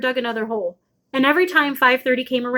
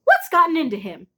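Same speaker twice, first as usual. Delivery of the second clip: mother calling; 6 semitones higher than usual. Acted. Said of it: "my mother cried".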